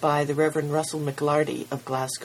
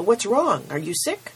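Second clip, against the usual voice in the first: put-on worried boy; 6 semitones higher, 4 dB louder than usual. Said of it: "cried Milo".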